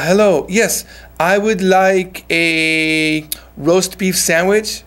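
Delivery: slow, clearly projected order; clear, audible voice. Shown as a demonstration. Ordering a roast beef sandwich at Subway, clear and audible. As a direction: say it slowly so the counter hears you. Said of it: "聞こえるように".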